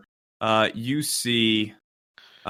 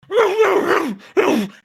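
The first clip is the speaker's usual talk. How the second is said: high barking yelp; dog bark; 6 dB louder than usual. Acted. Dogs start barking, GM voices them.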